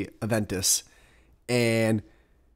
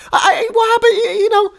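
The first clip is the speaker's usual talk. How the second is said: high, loud whiny crying voice; mock whine; 9 dB louder than usual. Viewer begging the YouTuber about Waco, high mock whine. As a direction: whimper like a let-down viewer, much higher. Mock whining for more info.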